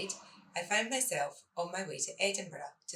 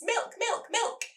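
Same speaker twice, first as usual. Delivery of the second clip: frantic; 3 dB louder, slower than usual. Acted. Frantic shopping with small children.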